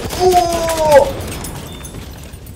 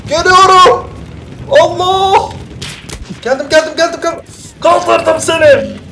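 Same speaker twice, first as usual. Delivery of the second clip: excited shouting; 5 semitones higher than usual. Real, not acted. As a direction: shout the revive in a rush. Heyecanlı medik oyunu. (heyecanlı bağırışlar)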